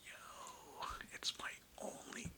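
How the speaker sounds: ordinary talk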